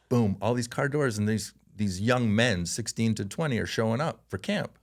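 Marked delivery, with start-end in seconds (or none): none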